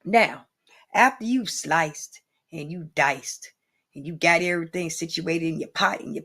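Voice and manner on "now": normal talking voice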